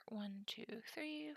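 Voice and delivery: soft unvoiced count; whispered counting. Real, not acted. Streamer counting cards, whispered under breath.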